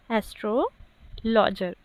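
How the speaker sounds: Astrologer said slowly, with a pause after astro; slow teacher's repeat of astrologer, split in the middle; word broken in parts. Shown as a demonstration. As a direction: dictate astrologer in two separate pieces, astro and logger. Repeats the correct word for students.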